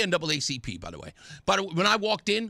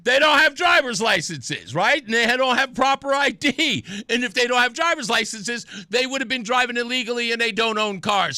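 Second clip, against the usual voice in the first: mocking rant; 4 semitones higher, 5 dB louder than usual. Real, not acted. Sarcastic rant with raised voice.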